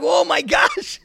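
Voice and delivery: high, loud mock astonishment; startled exclamation. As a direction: exclaim as if suddenly grabbed in a hug. Acts his startled reaction to embrace.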